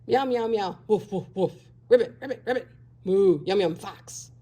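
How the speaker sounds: a string of mock animal sounds; animal noises. A narrator's reading. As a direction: scare off the fox with every noise. Bob scares the fox with sounds.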